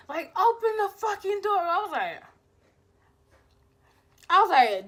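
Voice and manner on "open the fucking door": higher mock yell at the gate. Boyfriend shaking the locked gate, mock angry yell. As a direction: imitate him raging, pitched up. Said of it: "He was, like, shaking the gate".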